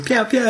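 high laughing pew pew; kid gun noises. Retold or imitated. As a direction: imitate kids firing toy guns. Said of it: "the Bairns are going".

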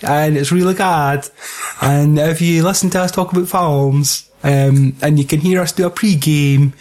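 badly faked American podcaster plugging away; mock American accent. Host parodying the American compadres' plug, mock American accent. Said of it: "our American compadres that sound like".

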